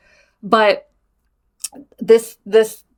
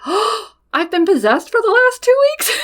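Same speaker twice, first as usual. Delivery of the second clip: much higher, incredulous squeal; shocked disbelief; 13 semitones higher, 4 dB louder than usual. Retold or imitated. Daughter hearing mom's diagnosis, high incredulous squeal. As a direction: shriek it in comic disbelief. Reacting to mom's possession news.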